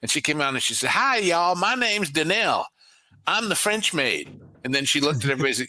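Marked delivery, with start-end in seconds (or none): 0.9-4.4 put-on Southern accent. Disney maid greeting the guests in a Southern accent. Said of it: "Southern accent who played the part of the French maid".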